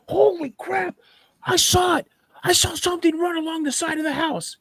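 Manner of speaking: very high, squealing alarm; acted-out freak-out at top pitch; startled panic. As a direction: jump back and cry out in a high voice. Friend jumps back from window, scared.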